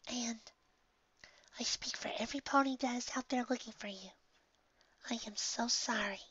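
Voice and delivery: soft, high, breathy whisper; Fluttershy whispering. A narrator's reading. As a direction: whisper an apology gently. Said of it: "Fluttershy whispered to her".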